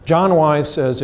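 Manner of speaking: usual speaking voice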